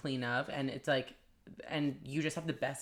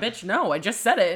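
higher, louder fed-up retort; exasperated snap; 7 semitones higher, 11 dB louder than usual. Retold or imitated. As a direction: bite back at the customer in mock irritation. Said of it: "And I'm like".

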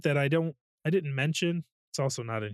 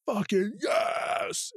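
drawn-out, much higher triumphant yell; triumphant cheer; 8 semitones higher, much slower than usual. Retold or imitated. Cheering the sky whale going down, slow triumphant yell. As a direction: stretch it like a victory cry. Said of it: "And it's like".

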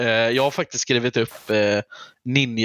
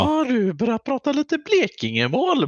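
mocking parents; 13 semitones higher than usual. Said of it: "Morsan och farsan är ju verkligen sån här".

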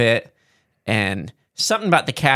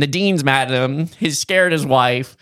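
ordinary delivery

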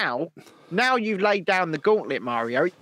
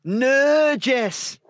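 loud, higher mock protest; 4 semitones higher, 5 dB louder, much slower than usual. Playful protest at Jess.

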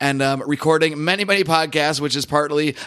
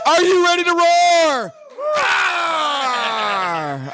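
hype shout; much slower than usual. Real, not acted. Host firing up the live PorcFest audience, big roaring shout. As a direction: whip the room up, then roar it out.

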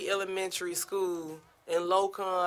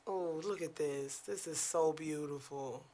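softly admiring, much softer, lower, breathed out in wonder; quiet awe; 3 semitones lower, 10 dB quieter than usual. Real, not acted. Reader admiring photos of the school bus, soft awe. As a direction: gaze at something lovely and murmur your delight.

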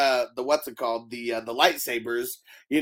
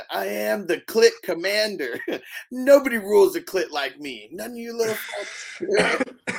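mock commander; 7 semitones higher than usual.

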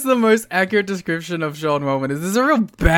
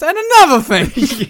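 indignant; 11 semitones higher, 9 dB louder, slower than usual. Retold or imitated. Mocking endless promo.

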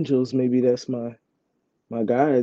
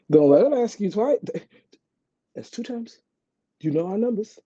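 high, excited voice pushing her to leave; a gleeful devil on the shoulder, pitched up; urging inner voice; 8 semitones higher than usual. Retold or imitated. Inner voice urging her to take the offer, high and excited. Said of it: "voice in my head".

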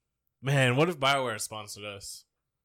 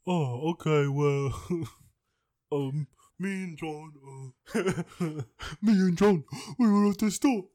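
put-on old [name]; 5 semitones higher than usual. Imitating old McCartney for a friend, high Liverpool voice. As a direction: ramble like an old Beatle, breaking into laughs. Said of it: "That's your [name]".

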